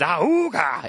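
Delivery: ooga horn noise; mock clown horn; clown horn honk.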